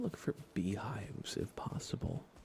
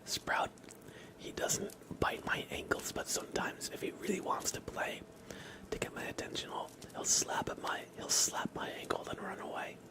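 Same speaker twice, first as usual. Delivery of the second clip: ASMR whisper; 12 semitones higher than usual. Real, not acted. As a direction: whisper close to the mic, unhurried.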